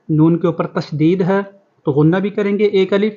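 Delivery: ordinary talk, nothing marked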